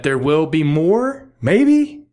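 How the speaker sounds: squeaky-high, exaggerated question on "maybe"; emphatic asking. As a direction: push "maybe" way up in pitch, loud and questioning. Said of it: "they're asking, but they're asking emphatically".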